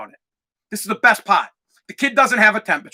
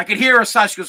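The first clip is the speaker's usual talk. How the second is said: usual speaking voice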